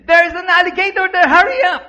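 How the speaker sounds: high, fast, urgent call; screamed warning. Acted. Mother's warning shouted in the story.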